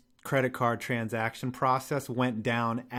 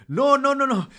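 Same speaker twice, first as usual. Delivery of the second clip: much higher, louder mock cabbie protest; mimicking pleading cabbie; 12 semitones higher, 5 dB louder than usual. Retelling the cabbie begging him to stay, high loud mock voice. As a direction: yelp the no's like a frantic driver. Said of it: "And he was like".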